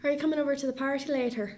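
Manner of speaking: quick Irish-accented warm-up phrase. Shown as a demonstration. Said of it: "Here's my phrase".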